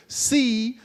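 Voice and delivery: normal talking voice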